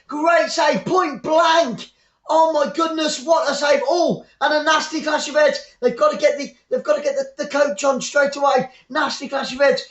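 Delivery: high, shouting play-by-play of a big save; excited commentary. Real, not acted. Commentator calling a point-blank save to viewers, excited shout. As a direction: yell it as the keeper pulls off the save.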